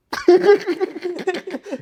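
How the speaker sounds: real laugh, pitched high; high burst of laughter. Real, not acted. Laughing while telling the captain story, real laugh. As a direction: laugh out at the memory. Laughs at own washroom request.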